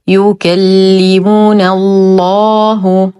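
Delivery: slow, drawn-out tajweed recitation; Quran recitation. Shown as a demonstration. Teacher models the phrase for learners, slow measured recitation. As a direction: recite with held vowels.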